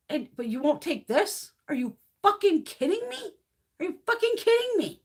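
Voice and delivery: high-pitched incredulous rant; worked-up, disbelieving telling-off; exasperated anger. Retold or imitated. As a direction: scold the vaccine refusers in disbelief. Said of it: "they make me angry".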